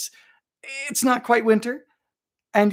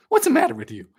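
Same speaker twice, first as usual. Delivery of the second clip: mock outrage; 10 semitones higher, 5 dB louder, faster than usual. Real, not acted.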